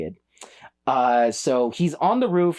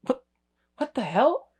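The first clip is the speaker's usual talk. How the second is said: baffled disbelief; 9 semitones higher than usual. Real, not acted. Baffled reaction to dialogue.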